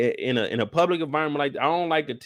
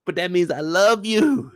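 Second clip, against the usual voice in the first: imitating Q; 4 semitones higher, slower than usual. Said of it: "He just told her".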